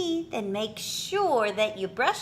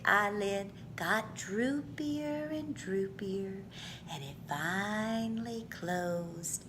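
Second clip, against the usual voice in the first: fading to a whisper; 7 semitones lower, 10 dB quieter than usual. A narrator's reading. Lullaby, child falls asleep.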